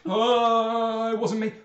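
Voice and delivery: high, loud drawn-out wail; squealing protest of innocence; panicked jailer. Acted. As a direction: blurt a frightened denial. Caught jailer pleads innocence.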